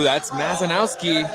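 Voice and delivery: ordinary talk, nothing marked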